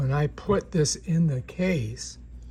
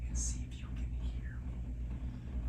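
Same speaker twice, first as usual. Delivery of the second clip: quiet breathy mic-test whisper; 12 dB quieter than usual. Shown as a demonstration. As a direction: whisper close to test the lavalier. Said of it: "I'm going to whisper into the microphone".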